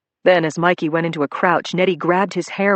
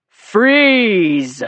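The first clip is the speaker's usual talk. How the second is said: loud, higher commanding yell; 5 semitones higher, 7 dB louder than usual. Acted. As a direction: bark the order to stop. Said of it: "He yelled".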